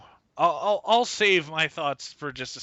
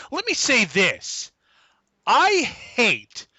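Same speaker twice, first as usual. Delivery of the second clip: higher, slow, punched words; emphatic declaration; 5 semitones higher, 5 dB louder, much slower than usual. Real, not acted. Host launching a rant at TNA fans, emphatic. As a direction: lay down each word hard.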